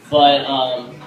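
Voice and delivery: ordinary talk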